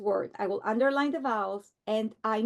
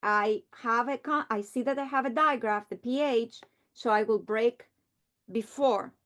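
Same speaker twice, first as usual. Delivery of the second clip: usual speaking voice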